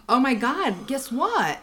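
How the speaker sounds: gasp, then feigned big news; fake astonishment. Mom baiting Bree during a phone scroll, mock-astonished. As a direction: put on a breathless lead-in to a prank. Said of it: "that's when I did the".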